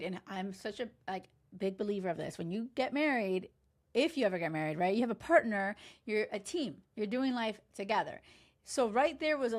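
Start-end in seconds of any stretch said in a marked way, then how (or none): none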